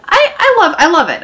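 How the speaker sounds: high and loud insistence; emphatic. Real, not acted. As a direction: insist you love it. Emphatic reassurance of love.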